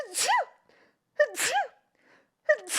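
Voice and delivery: short sharp sneezes; quick burst of sneezes; sneezing. Real, not acted. Real sneezing fit.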